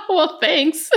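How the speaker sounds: high, laughing mock offence; laughing mock thanks. Real, not acted. Podcaster to co-host after an accidental dig, laughing mock thanks. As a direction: say it through real laughter, playfully slighted.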